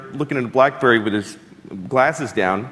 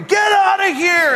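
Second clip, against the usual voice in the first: much higher, slow barked order; security shouting; 4 dB louder, slower than usual. Retold or imitated. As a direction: shout off the intruding car. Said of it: "they go crazy".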